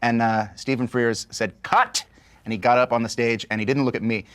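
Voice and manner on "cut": high called-out cut; retold director's call. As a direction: call cut across the stage. Director stopping the take.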